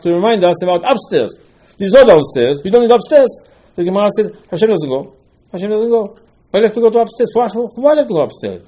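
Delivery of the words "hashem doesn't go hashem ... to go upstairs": higher-pitched mock protest; voiced objection, pitched up; acted objection. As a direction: argue the point in a raised, questioning voice.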